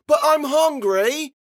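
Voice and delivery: put-on high, loud, drawn-out child's complaint; whining little girl. A narrator's reading. Hungry girl protesting to Daddy, whining loudly. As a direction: whine it long and high.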